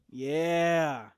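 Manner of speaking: usual speaking voice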